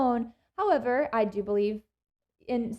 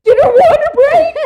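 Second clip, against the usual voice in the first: much higher, much louder mimic of an excited mom; 18 dB louder than usual. Mimicking mom squealing at the water breaking. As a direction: squeal it like an overexcited mother. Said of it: "And Mom goes".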